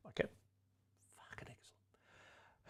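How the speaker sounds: a sarcastic breathy whisper, barely audible; whispered aside. Real, not acted. Host whispers a sarcastic aside to himself about his own question, whispered. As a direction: sigh it out under your breath, dry and sardonic.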